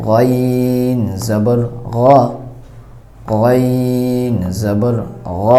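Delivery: very slow, গ said with a thick, rounded vowel; গ stretched with the vowel close to o; heavy গইন recitation. Shown as a demonstration. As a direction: draw out গইন জবর গ, heavy and dark. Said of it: "পরিপূর্ণ আকারের মতো হবে না".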